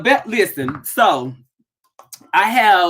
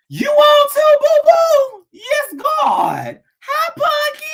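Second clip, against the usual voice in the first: excited squeal; slower than usual. Real, not acted. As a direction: squeal with delight at friends. Greeting friends joining live.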